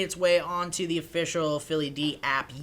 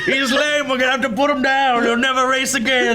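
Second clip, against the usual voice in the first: dramatic horse owner; 6 semitones higher, 8 dB louder than usual. Acted.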